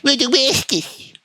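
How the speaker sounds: slow, high goofy impression; mocking character voice. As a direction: drag it out in a silly high voice. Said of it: "He talks like that".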